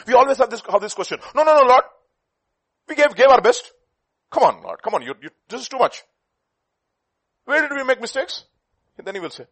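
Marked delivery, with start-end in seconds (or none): 1.3-8.4 high, loud pleading priests; mock protest. Priests protesting to the Lord, high whining plea. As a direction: whine back at the Lord in protest.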